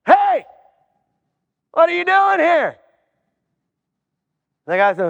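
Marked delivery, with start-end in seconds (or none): 0.1-2.8 a startled gatekeeper demanding answers; heaven's gatekeeper. Acted. Gatekeeper questions a newcomer at heaven.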